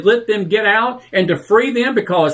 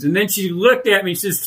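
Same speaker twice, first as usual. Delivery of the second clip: usual speaking voice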